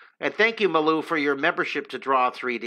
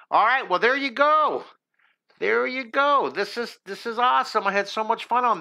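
delighted; 7 semitones higher than usual. Real, not acted. Delighted wrap-up of drawing.

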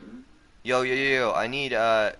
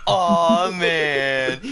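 mocking whine; 5 semitones higher, 6 dB louder than usual. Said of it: "you're like".

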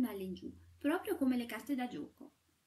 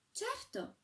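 much higher bright yes; eager exclamation; 13 semitones higher than usual. A narrator's reading. Shepherdess to the sweep, much higher eager certainty. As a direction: fire back a high eager yes. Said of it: "esclamò la fanciulla".

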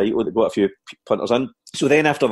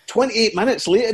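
ordinary talk, nothing marked

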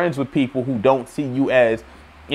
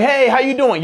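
eager greeting; 9 semitones higher than usual. Retold or imitated. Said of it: "And I'm like".

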